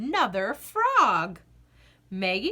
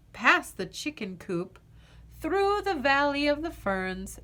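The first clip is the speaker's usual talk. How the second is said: usual speaking voice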